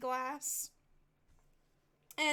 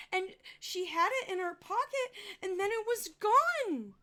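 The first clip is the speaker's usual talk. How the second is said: put-on higher boy's voice, sincere and indignant; a kid insisting it's just not right; earnest little boy; 7 semitones higher, 3 dB louder than usual. Boy explains to his uncle.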